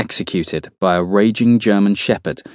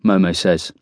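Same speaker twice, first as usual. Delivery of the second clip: ordinary delivery, nothing marked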